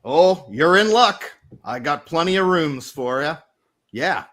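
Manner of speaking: put-on obliging New England old salt; New England accent. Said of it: "It's a New Englandy thing".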